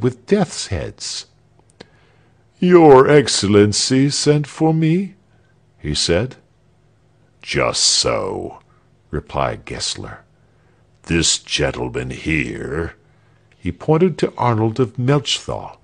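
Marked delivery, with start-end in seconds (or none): 2.6-5.1 high, loud, courteous official; kindly old executioner. A narrator's reading. 7.4-8.6 low-pitched tyrant's curt assent; cool governor. A narrator's reading. 11.0-12.9 low, slow, lordly tyrant; cool governor. A narrator's reading.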